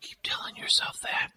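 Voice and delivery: breathy sarcastic whisper. Acted. Mocking whisper to a co-host. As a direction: hiss it under your breath, mocking.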